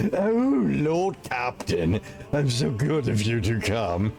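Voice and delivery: hammy stage actor; mincing, overdone theatrical voice; theatrical bitch voice. Acted. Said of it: "a super theatrical bitch voice".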